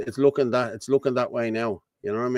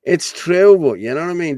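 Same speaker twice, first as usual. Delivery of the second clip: ordinary talk, nothing marked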